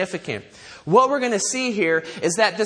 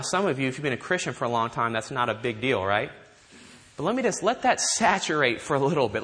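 usual speaking voice

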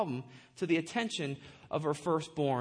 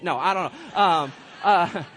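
ordinary delivery

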